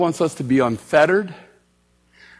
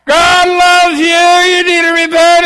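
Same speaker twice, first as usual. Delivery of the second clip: mocking street preacher; 9 semitones higher, 10 dB louder than usual. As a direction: ham up a street preacher's cry. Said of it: "And I said".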